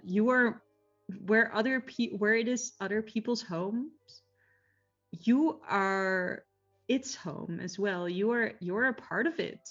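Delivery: ordinary talk, nothing marked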